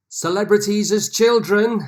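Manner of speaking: loud, high-pitched title reveal; big announcement. Real, not acted. Quizmaster revealing the picture round to players, loud announcement.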